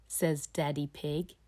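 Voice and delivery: plain reading voice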